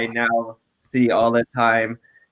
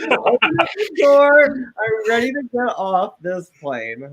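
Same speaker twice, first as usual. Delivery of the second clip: high, slow, drawn-out comic demand; mock outraged demand; 8 semitones higher, slower than usual. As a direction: demand the exit through laughter, pitched way up. Acting out drunk demand to leave.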